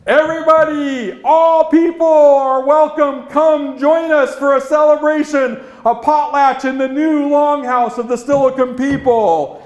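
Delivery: loud, high chief's call across the village. Acted. Leader calling everyone to the potlatch, loud and ringing. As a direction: call the whole tribe to come.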